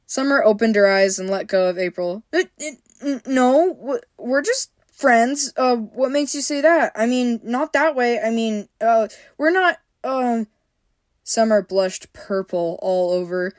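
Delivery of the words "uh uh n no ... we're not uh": flustered stammer. A narrator's reading.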